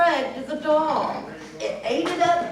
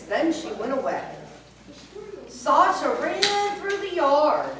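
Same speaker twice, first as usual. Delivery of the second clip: ordinary delivery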